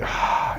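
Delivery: exasperated exhale, acted out; heavy breath out, mimicking an annoyed rabbi; big imitated sigh. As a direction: let out a big, aggravated sigh. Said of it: "he let out this big".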